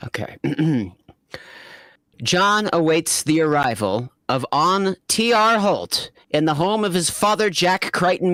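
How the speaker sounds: normal talking voice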